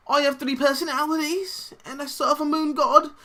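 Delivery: weird high-pitched put-on accent; Oscar Isaac. Mocking the actor's trailer voice, weird high accent. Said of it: "He's going for, like, a weird".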